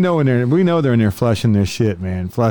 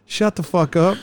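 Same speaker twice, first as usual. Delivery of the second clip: mock snap; 8 semitones higher than usual. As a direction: bark it at him. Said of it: "I'm like".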